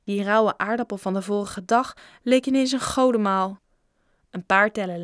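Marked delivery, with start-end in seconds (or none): none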